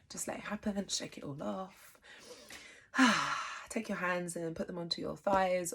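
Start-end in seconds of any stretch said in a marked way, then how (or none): 2.0-3.7 loud open-mouthed sigh out; big release sigh. Shown as a demonstration. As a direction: let it all go on a heavy exhale. Demonstrates shaking it all off.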